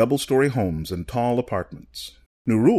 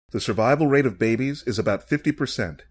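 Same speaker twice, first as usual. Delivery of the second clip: usual speaking voice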